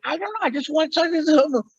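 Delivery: high, quick comic character voice; goofy high-pitched character; Pee-wee. Retold or imitated. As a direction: answer in the squeaky put-on movie voice. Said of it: "talk like this".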